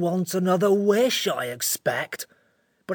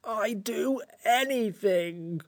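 slow, soft, tearful creature; drawn-out, plaintive creature voice; 3 semitones higher, 5 dB quieter, much slower than usual. A narrator's reading. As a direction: moan it out close to tears. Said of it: "it said in a tearful voice".

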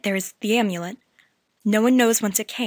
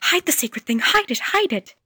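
much higher, louder pleading; frantic girl; 4 dB louder than usual. A narrator's reading. Ancient girl begging them to hide the charm, frantic. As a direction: beg urgently. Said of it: "whispered the girl".

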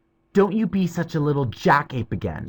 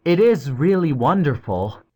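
put-on boyish voice, higher and louder, talking down; patronizing; 11 semitones higher, 6 dB louder than usual. A narrator's reading. Cyril showing off to the others, patronizing. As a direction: pat the past on the head, smugly. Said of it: "said Cyril patronizingly".

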